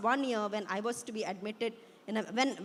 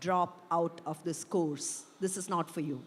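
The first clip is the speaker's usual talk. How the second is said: lightly hissed inner voice; 5 semitones lower than usual. A narrator's reading. Nagging thought in a student's head, low hint of hissing. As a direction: draw it out low, a hint of snake hiss. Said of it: "hisses and spits in my head like a snake".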